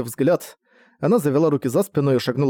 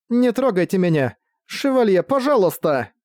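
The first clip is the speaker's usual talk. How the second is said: higher-pitched, distressed appeal; pleading; 6 semitones higher than usual. A narrator's reading. Pinned woman begging the knight for help, pleading. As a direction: beg them to stop without shouting.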